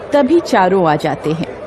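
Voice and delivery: ordinary delivery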